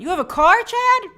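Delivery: loud, high disbelieving question; surprised. Real, not acted. Host teasing a New York viewer, loud high disbelief. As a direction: sound amazed that Chad owns a car.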